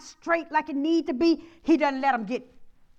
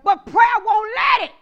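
loud, higher preacher's cry, rising to a shout; preaching at full force; 10 semitones higher, 12 dB louder than usual. Acted. Pastor rallying the congregation against the enemy, shouting. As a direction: shout down the trouble like a fired-up pastor.

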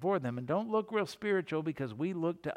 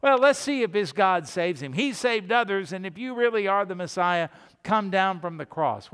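loud, higher mocking taunt; jeering crowd; 4 semitones higher, 5 dB louder than usual. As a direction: jeer at him from the crowd. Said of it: "what do they say to him".

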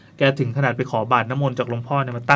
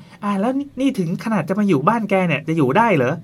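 a lightly voiced nosy neighbour, a bit higher; gossiping villager; 6 semitones higher than usual. Villager doubting the aunt can stay, mock gossip voice. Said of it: "อีกคนก็".

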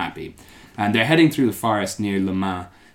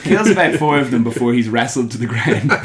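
loud, higher voice through laughter; laughing; 4 semitones higher, 6 dB louder than usual. Real, not acted. Host telling friends of the king's rampage, laughing. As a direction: tell it while cracking up.

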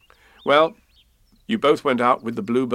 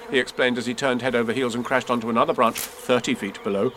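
ordinary delivery, nothing marked

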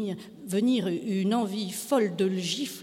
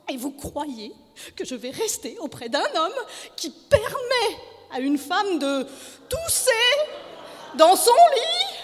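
high, rising indignation; frantic high-pitched outrage; outraged wife; 11 semitones higher, 7 dB louder than usual. A narrator's reading. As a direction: build from disbelief to a shriek. Frantic wife repeats her outrage.

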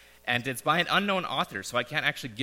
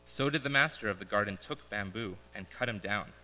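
solemn parable reading with pauses; story narration; 5 dB quieter than usual. Acted. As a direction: read it like a gentle fable to the congregation. Reads a parable aloud.

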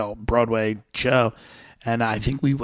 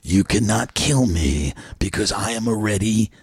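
low menacing movie villain; deep dramatic villain voice; 3 semitones lower, slower than usual. Pretend. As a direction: growl it like a trailer villain. Dramatic trailer character line.

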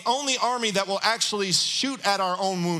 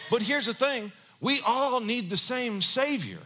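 ordinary delivery

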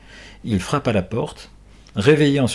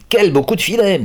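delighted exclamation; 11 semitones higher, 6 dB louder than usual. Acted. Gendarme delighted by the catch.

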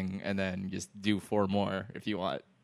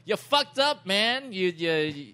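angry police chief; 8 semitones higher, slower than usual. Retold or imitated. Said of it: "And they're like".

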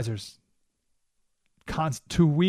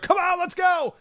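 very high, excited mock cheer; ecstatic advisors; 4 dB louder than usual. Advisors thrilled after the win, mock ecstatic cheer. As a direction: cheer them on like thrilled fans. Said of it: "They're ecstatic".

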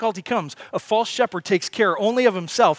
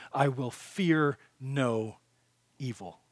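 slow and hushed, dropping away to almost nothing on evil; soft fading declaration; 3 semitones lower, 5 dB quieter, much slower than usual. Acted. Preacher quietly sealing the point to listeners, slow and fading. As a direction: let the line sink into a hush. Softly restating the verse.

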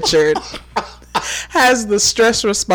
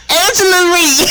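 laughing; 8 semitones higher, 5 dB louder than usual. Real, not acted. Laughing mispronounced name.

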